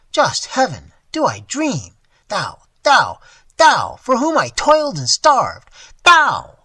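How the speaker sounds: high, loud, rising horrified outcry; anguished cry. Acted. Old miser catching his ward robbing him, horrified loud cry. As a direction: cry out in disbelief at the thief. Old man wakes to see robber.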